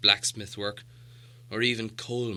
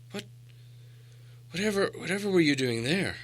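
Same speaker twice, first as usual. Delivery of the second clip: a shiver of dread in a higher, stammered question; shuddering; 8 semitones higher, 3 dB quieter than usual. A narrator's reading. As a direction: ask it as if a chill ran through you. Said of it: "asked Thorin with a shudder".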